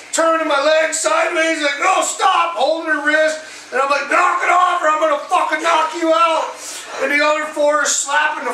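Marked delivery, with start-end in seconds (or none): 1.8-2.6 raised-pitch plea to stop; high, acted urgency; urgent protest. Re-enacts fending off an attacker. 4.1-6.5 raised-pitch retold threat; heated, higher re-enacted threat; angry warning.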